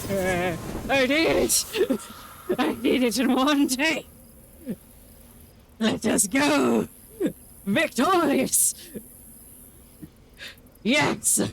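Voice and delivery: grand triumphant proclamation; mock-heroic victory cry; put-on victorious voice. Real, not acted. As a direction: proclaim the win in a silly put-on voice. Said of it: "I'm still speaking in this voice".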